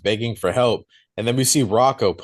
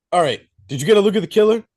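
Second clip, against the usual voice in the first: fast, higher urgent questioning; rushed question; 8 semitones higher, 3 dB louder, much faster than usual. Retold or imitated. Deputy quizzing the caller fast and high. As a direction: rattle it off quickly. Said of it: "And he's like".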